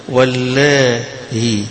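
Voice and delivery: واللائي with the hamza eased toward a light h, welāhi; softened hamza. Shown as a demonstration. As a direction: glide through واللائي without a full stop. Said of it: "فيها تسهيل".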